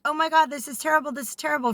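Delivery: imitated fretting, quick and high; mock freaking out. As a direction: rattle it off like someone mildly panicking. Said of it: "freaking out about something".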